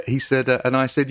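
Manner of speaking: ordinary talk, nothing marked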